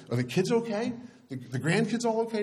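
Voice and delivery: high-pitched anxious questioning; worried husband. Retold or imitated. Husband asking crying wife about the kids, mock anxious. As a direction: press for news, voice pitched right up.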